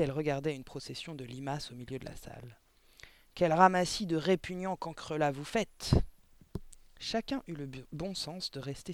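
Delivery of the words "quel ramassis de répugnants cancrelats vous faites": loud, contemptuous headmistress; disgusted scolding. A narrator's reading. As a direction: insult the class loudly. Directrice insultant toute la classe. (réprimande forte et dégoûtée)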